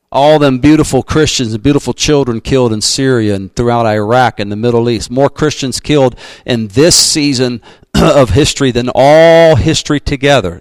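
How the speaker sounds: usual speaking voice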